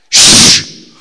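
a sharp loud shush; hush. Retold or imitated. Jesus silencing the storm, sharp loud shush. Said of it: "came out and said".

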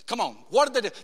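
usual speaking voice

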